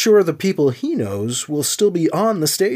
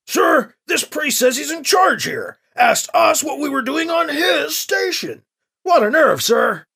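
indignant report; 9 semitones higher than usual. A narrator's reading. Soldier reporting the priest's claim to his commander, indignant. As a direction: complain up the chain, full of indignation.

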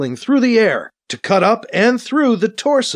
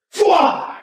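raised, whooshing energy-gun shot; rifle blast sound; 10 semitones higher than usual. A narrator's reading. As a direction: imitate a laser blast with the mouth. Narrator voices rifle blast sound effect.

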